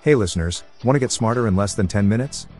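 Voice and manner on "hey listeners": ordinary delivery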